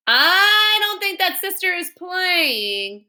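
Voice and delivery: slow, high, timid little lady; Baby Lincoln voice. Acted. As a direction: draw it out like a fretful old lady. Baby voiced in read-aloud.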